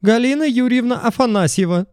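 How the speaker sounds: high hysterical woman's voice. A narrator's reading. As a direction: announce the name, high and frantic. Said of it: "истеричный женский голос".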